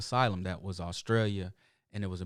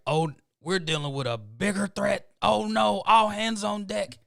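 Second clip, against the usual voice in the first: high-pitched comic panic, getting louder; mock alarm; 9 semitones higher, 4 dB louder than usual. Installer reacting to a foreign threat, mock alarm. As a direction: raise the alarm, building to a loud high call. Acted alarm at Russian accent.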